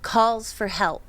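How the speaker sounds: usual speaking voice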